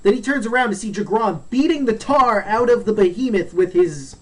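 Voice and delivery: ordinary talk, nothing marked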